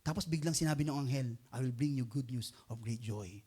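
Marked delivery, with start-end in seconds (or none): 1.5-3.4 angel's announcement. Retold or imitated. Preacher voicing the angel, low and slow. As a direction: lower the voice and slowly announce good news.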